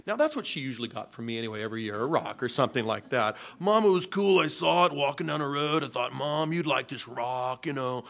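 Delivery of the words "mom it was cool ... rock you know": put-on loud, high kid's pitch to mom, easing down; excited excuse to mom. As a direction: eagerly sell a silly gift to your mother, loudly. Retelling his own excuse to mom.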